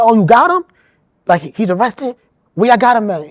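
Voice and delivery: mom's voice jumps up, loud and eager; retold mom, loud and eager; excited surprise. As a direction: blurt it out like the news is a thrill. Mom hears her son was seen.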